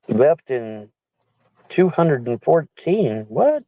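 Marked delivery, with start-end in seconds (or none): 3.3-3.6 sharp high startled what; surprised. Real, not acted. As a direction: react in disbelief. Reacts to misread year.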